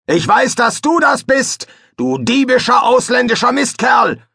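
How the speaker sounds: high, loud, enraged yell across the water; furious shout. Acted. Fester yells at the rival fisherman across the water, high and furious. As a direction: bellow the insult at the rival.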